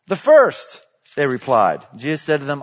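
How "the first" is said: crowd answer. Acted.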